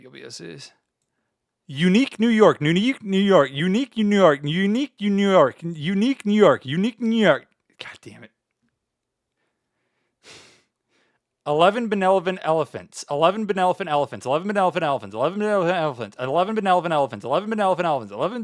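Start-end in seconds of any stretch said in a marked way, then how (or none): 1.7-7.5 loud, hammered tongue twister; loud tongue twister repeated. Shown as a demonstration. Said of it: "We gotta read tongue twister". 11.5-18.4 loud, stumbling tongue twister; loud struggling tongue twister. Shown as a demonstration. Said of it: "We gotta read tongue twister".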